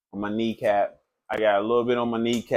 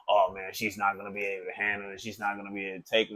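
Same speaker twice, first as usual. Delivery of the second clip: quick, quieter mock boyfriend; mimicking the boyfriend; 4 semitones lower, 9 dB quieter, much faster than usual. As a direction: rattle off his worry fast and low. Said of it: "he's just like".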